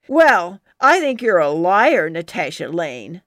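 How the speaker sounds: put-on a rival kid accusing the storyteller before the crowd; accusing challenge. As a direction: call her out in front of everyone. Susie accusing Natasha.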